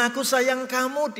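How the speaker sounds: high, sing-song mock of a love note; high teasing coo; mock sweet. Mocking the sweet message.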